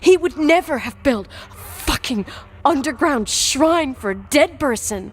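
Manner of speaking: furious shout. Acted. Daughter rages at accusation.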